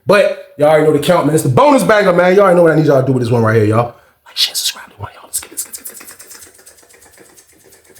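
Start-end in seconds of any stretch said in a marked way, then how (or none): 4.2-8.0 fading whispered chant; whispered plug. Real, not acted. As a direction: whisper it rapidly down to nothing. Outro plug dropping to whisper.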